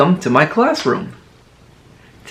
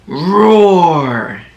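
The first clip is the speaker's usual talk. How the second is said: playful growled Roar; mock roar for children; tiger roar; 2 semitones higher than usual. Shown as a demonstration. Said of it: "Tigers say".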